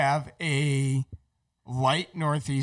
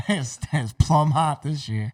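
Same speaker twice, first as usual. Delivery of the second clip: mock Southern accent; 2 semitones lower, slower than usual. Mocking Southern speech.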